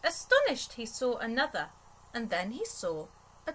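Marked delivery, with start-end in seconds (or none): 0.0-0.7 lifted, surprised read; astonished. A narrator's reading. Reading to a child, a gasp of astonishment. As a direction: let the surprise lift the word. Narrator reads Teddy's surprise.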